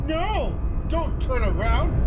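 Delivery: high, loud terrified shouts; panicked yelling. Real, not acted. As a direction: yell in real fear. Panicking at monster in game.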